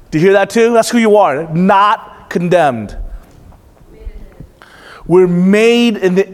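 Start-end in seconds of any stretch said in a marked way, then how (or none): 1.5-3.0 Not condemned stretched very slowly, Not stressed; slow emphatic echo. Shown as a demonstration. Preacher drives the truth home to his church, very slow. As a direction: lean hard on the word Not.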